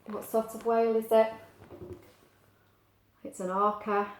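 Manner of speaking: ordinary delivery, nothing marked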